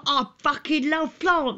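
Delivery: high, slow put-on naturalist; mock David Bellamy. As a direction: ham up a bearded nature presenter. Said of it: "he's all like".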